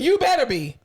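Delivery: ordinary talk, nothing marked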